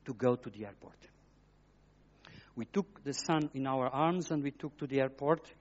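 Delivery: usual speaking voice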